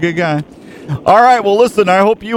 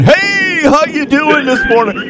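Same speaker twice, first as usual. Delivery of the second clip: high, hyped radio announcer; radio host impression; 12 semitones higher than usual. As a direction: pump energy into the mic. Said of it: "He goes".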